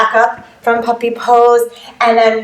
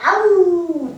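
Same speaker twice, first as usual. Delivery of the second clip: high little howl; playful dog howl; 8 semitones higher than usual. Pretend. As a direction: howl along with the dog pose. Howls after upward dog pose.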